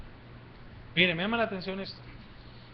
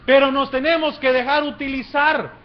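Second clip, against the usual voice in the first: high, loud preacher's rhetorical question; raised, driving sermon delivery; impassioned preaching; 8 semitones higher, 8 dB louder than usual. Real, not acted. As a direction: preach it at full force, phrase by phrase. Preaches at full force.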